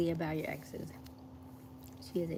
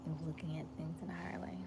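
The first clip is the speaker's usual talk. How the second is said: whispering; 2 semitones lower, 10 dB quieter than usual. Real, not acted. Unvoiced whisper.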